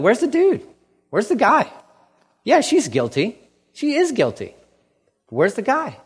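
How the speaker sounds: high, incredulous demanding of the missing man; pointed challenge. Retold or imitated. Jesus confronting the accusers, asking high and incredulous. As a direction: press them, mock disbelief.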